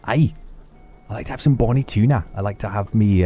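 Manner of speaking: put-on high, playful Scottish accent for a wee cat. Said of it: "make an accent for my cat".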